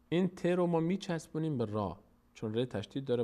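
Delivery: ordinary talk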